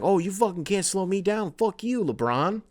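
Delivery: cocky trash talk. Retold or imitated. Trash talk quoted from player.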